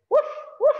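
very high yappy puppy bark; puppy woof. Acted. Reading to a child, high puppy woof. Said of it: "my woof is a little too high-pitched".

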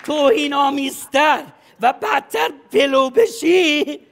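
slow, loud, drawn-out insistence; slow shouted advice; emphatic shout. Acted. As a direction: hammer the point home, loud and slow. Coach insisting, heard shout.

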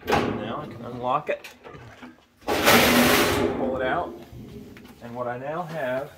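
ordinary delivery

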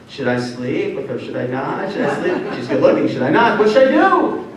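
wavering back and forth, climbing in pitch; moral ambivalence. Acted. Joseph debating whether to sleep with her, increasingly agonized. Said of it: "it's reflecting his immoral ambivalence".

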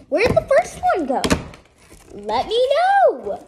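high loud frustrated question; frustrated outcry. Real, not acted. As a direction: demand it, exasperated. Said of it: "I'm really mad".

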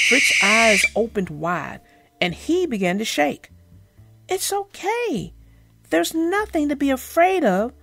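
gentle read-aloud for children, voicing each animal; story narration. A narrator's reading. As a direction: tell it like a bedtime storybook.

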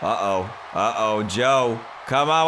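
normal talking voice

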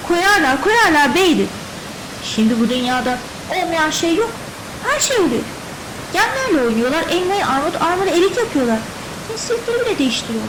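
high, loud, insistent protest; wife arguing back. A narrator's reading. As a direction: argue it heatedly as the wife. Wife argues back at husband.

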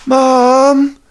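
high, drawn-out call for mom; little sister calling out. Retold or imitated. Little sister calling mom at night, high drawn-out call. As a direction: call out as a child wanting mom. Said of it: "hearing her go".

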